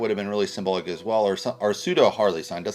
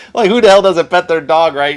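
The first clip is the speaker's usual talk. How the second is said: loud, higher laughing outburst; laughing disbelief; 8 semitones higher, 13 dB louder than usual. Real, not acted. Reviewer laughing loudly at the dog scene, high laughing outburst.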